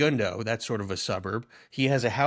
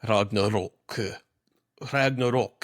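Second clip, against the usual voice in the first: Ragnarok repeated in an odd put-on accent; weird accent; 2 semitones lower than usual. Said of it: "That's a weird accent".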